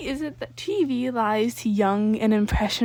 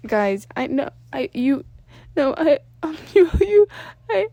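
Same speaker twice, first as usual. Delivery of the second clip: higher, louder voice breaking; near tears; 4 semitones higher, 7 dB louder than usual. Acted. Danny struggling toward tears before his friends. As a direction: choke on the words. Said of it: "brink of crying as he struggled".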